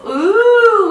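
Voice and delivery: loud, high ooh; playful mock gasp; mock surprise. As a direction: act delighted at the card. Reveals the card.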